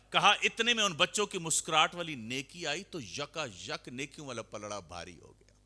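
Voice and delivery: ordinary talk, nothing marked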